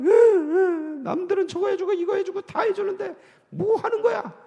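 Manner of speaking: mock-crying, high kid's voice; mock child crying to parents; sobbing child. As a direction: wail like a bratty child. Child's whimpering complaint.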